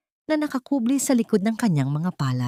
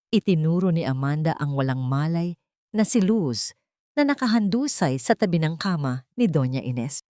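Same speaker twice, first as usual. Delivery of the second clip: ordinary delivery, nothing marked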